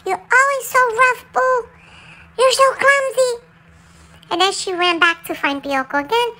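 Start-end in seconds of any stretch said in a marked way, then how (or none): 0.1-3.4 sharp scolding, higher and louder; scolding mom. Retold or imitated. Mom scolding the dog for pushing Pioco, sharp and loud. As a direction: scold the clumsy pup hard. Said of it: "she told me".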